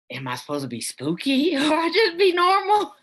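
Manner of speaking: high laughing squeal of a question; laughing self-quote. Retold or imitated. Actress asking the director, laughing high and loud. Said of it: "So I was going".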